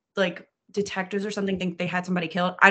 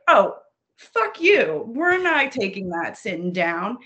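mock defiance; 3 dB louder, slower than usual. Voicing older women assassins refusing to be killed, mock defiance. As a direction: playfully act out outraged refusal.

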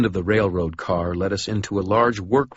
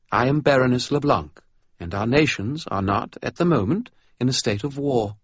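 high, cool, formal British vampire; regal vampire; 7 semitones higher than usual. Acted. Vampire baroness greeting Dresden, cool and formal. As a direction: speak with icy poise.

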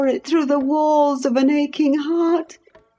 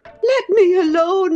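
high, begging voice, on the edge of sobs; sobbing Catherine; 13 semitones higher than usual. Acted. Catherine begs Heathcliff to leave her be, nearly sobbing. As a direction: plead to be left alone, near tears. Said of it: "sobbed Catherine".

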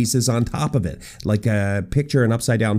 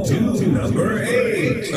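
whisper; 8 semitones higher, much slower than usual. Acted.

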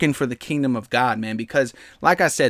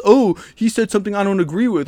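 higher, louder whiny mock voice; mock offended listener; 6 semitones higher, 5 dB louder than usual. Mocking offended podcast listeners in a higher voice. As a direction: mimic an offended critic. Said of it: "people that are gonna be offended".

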